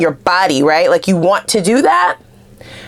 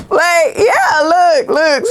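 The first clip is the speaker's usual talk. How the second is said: laughing; 9 semitones higher, much slower than usual. Real, not acted. Laughing excitedly at a card.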